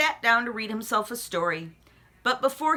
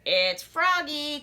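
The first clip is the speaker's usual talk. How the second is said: croaky frog; 6 semitones higher, slower than usual. A narrator's reading. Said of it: "croaked a deep voice".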